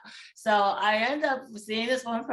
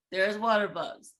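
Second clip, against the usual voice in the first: ordinary talk, nothing marked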